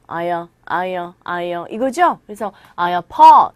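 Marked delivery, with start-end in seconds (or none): 0.1-1.5 "I" gliding into a weak "a", low; linked weak vowel. Shown as a demonstration. Teacher drilling the linked sound, weak vowel after I. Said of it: "덩어리로 발음을 해주셔야 됩니다". 2.8-3.5 "I apo-" with a loud, punched "po"; stress on "po". Shown as a demonstration. Teacher showing learners the stressed part, loud "po". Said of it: "이 부분에 강세를 주시면".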